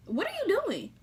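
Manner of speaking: high, incredulous question; shocked question. Retold or imitated. Retelling her reaction to him, high and incredulous. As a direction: act baffled at him.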